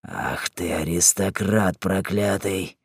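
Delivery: low, slow, hushed menace; soft, deep, drawn-out cursing; growled curse. A narrator's reading. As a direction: mutter the insult slowly with contempt. Simon curses Lorin in quarrel.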